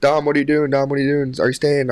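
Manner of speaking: acting out a flustered friend; imitated panic. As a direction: fire off anxious questions like worried teammates. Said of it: "everyone goes into panic".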